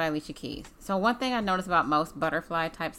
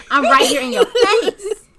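higher, louder, slow laughing taunt; mock pushy rival; 11 semitones higher, 13 dB louder, slower than usual. Singer chiding a man, loud laughing mock taunt. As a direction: taunt him as the other woman. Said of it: "She's like".

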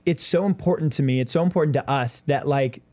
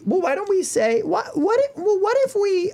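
much higher, squeaky teasing tone; high mocking voice; 14 semitones higher than usual. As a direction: pitch way up to mock. Mimics a high voice.

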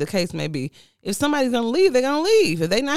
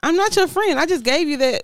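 indignant; 10 semitones higher, 4 dB louder than usual. Acted. Acted coworker exchange, indignant.

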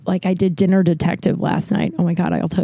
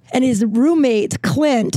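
ordinary talk, nothing marked

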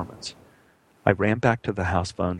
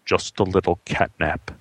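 mumbled under a laugh; 4 semitones lower than usual. Retold or imitated. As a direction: swallow a giggle while mumbling. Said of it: "Jane mumbled under a laugh".

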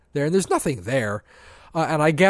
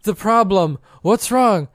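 groggy alarm; 6 semitones higher, 5 dB louder, slower than usual. Retold or imitated. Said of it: "And I'm like".

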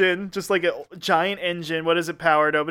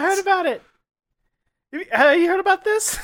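Jay Leno impression; 12 semitones higher than usual.

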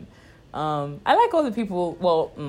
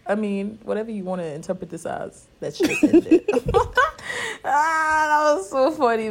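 laughing; 7 semitones higher than usual. Retold or imitated. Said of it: "I was like".

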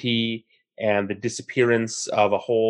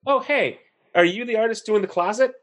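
higher, bright mock greeting from a passing cyclist; friendly stranger calling over; 10 semitones higher than usual. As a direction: call out a cheery hello, voiced in a retold story. Retold cyclist greets the artist.

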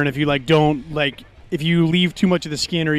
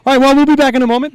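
show host sign-off; 6 semitones higher, 6 dB louder, faster than usual. Acted. Signing off to break.

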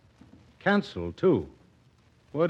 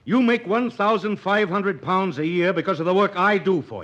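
loud, firm threat of a lawsuit; angry; 7 semitones higher, 5 dB louder than usual. Acted. Doctor angrily threatens his employer's wife with a lawsuit. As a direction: stand your ground and push back hard.

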